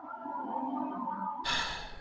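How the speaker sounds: long, soft, breathy exhale; long sigh. Acted. Leader facing a distressed crowd, long weary sigh. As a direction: sigh slowly before speaking. Leader sighs before answering.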